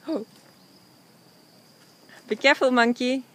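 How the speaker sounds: ordinary talk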